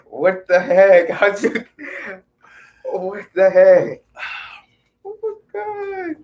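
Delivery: too happy for words; stunned disbelief. Real, not acted. [name] still stunned by the puppy, tearful disbelief.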